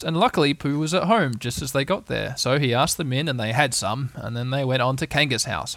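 usual speaking voice

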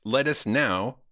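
stiff, formal android; mechanical, deliberate delivery; robot posing as human. Pretend. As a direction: pronounce it like a machine issuing a polite proposal. Continues robot small-talk bit.

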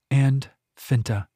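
usual speaking voice

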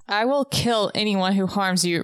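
normal talking voice

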